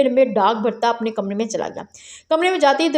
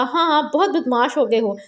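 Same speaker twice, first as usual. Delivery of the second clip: ordinary delivery, nothing marked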